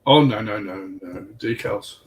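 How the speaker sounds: ordinary delivery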